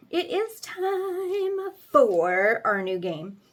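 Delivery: drawn-out game announcement; slow announcer voice. Acted. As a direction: announce it slowly, like a host.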